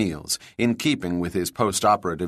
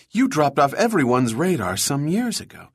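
words tumbling out quickly, voice climbing high; rush of words; 9 semitones higher than usual. A narrator's reading. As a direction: gush it all out in one breath. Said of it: "she said in a rush of words".

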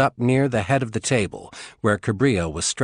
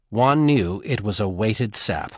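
ordinary delivery

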